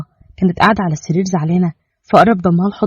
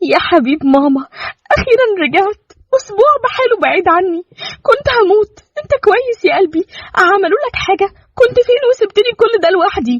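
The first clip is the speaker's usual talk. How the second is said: much higher, tearful relief; crying with joy; 13 semitones higher than usual. Acted. Mother weeping with joy as she holds her returned baby. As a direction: weep happily over the child. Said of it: "وعيطت من الفرحة وقالت".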